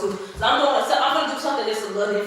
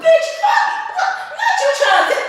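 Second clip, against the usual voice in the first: high, loud outburst of disbelief; a sudden outraged yelp, half laughing; outraged shriek; 6 dB louder than usual. Real, not acted. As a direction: squeal it out like you can't believe it. Outraged at content being stolen.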